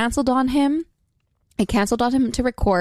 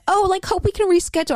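higher, sing-song mock of the guy; imitating polite guy; 9 semitones higher than usual. Retelling his texts to the cohost, higher mock nice guy. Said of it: "he was like".

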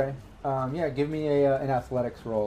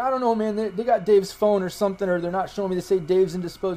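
higher-pitched, bewildered explaining; confused drunk guy; 6 semitones higher than usual. Acted. Drunk guy explains to his friend at the door, high and bewildered. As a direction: play the rattled drunk in a raised pitch.

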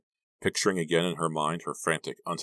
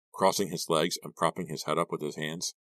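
ordinary delivery

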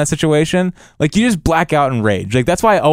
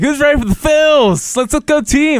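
hyped-up crowd rouser; 12 semitones higher than usual. Acted. Co-host mimics hyping fans before a batter, high and rousing.

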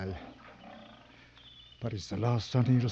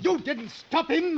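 high, slow, furious yell; angry shout; 4 dB louder, slower than usual. Acted. Hunter shouting at client over the wounded lion. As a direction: hurl the blame at him.

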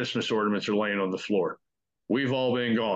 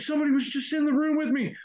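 much higher, quick frightened rush; faster than usual. Retold or imitated. Janiyah telling friends after her scream, frightened. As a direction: blurt it out still shaken.

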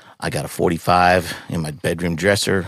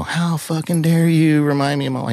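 high-pitched mock scream of outrage; retold scream; 10 semitones higher than usual. Firefighter venting alone driving home, retold scream. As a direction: re-enact yelling it, voice pitched way up. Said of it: "I'm screaming".

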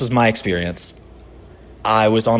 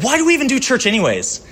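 higher, fed-up cry of "why bother"; exasperated outburst; 12 semitones higher, faster than usual. Retold or imitated. Pastor retelling his late-night vent to Paige, exasperated. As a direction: throw the question up in frustration. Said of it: "And I was like".

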